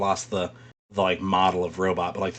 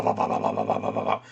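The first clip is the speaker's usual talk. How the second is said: gunfire noise; 12 semitones lower, 4 dB quieter, faster than usual. Pretend. Imitating power armor gunfire.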